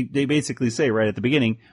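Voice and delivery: ordinary delivery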